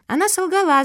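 put-on high, loud childish declaration; declaring little girl. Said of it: "заявила Куки".

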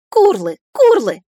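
loud, emphatic creature cries; punchy repeated dragon calls; scolding dragon calls. A narrator's reading. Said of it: "приговаривала она".

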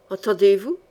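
usual speaking voice

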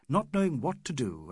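usual speaking voice

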